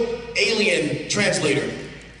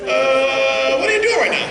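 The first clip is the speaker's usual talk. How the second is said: high, loud what are you doing; startled shout; loud surprised call; 7 semitones higher, 7 dB louder than usual. Real, not acted. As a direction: call out in disbelief. Volunteer caught acting strange.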